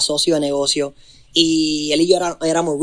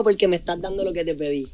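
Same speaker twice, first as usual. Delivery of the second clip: usual speaking voice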